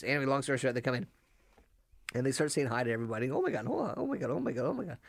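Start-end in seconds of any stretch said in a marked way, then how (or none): 3.3-5.0 high-pitched retold flurry of oh-my-Gods; excited greeting.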